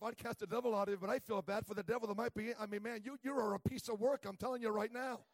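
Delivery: loud, emphatic comic rant; mock exasperated rant. Preacher voicing his thoughts about the cashier, loud mock rant. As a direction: pile it on for the laugh. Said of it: "I'm thinking to myself".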